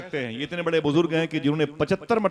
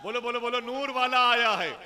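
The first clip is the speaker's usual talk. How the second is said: slogan call; 10 semitones higher than usual. Real, not acted. Preacher urges the crowd to repeat the slogan, chanted call. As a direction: call it high, urging everyone to join.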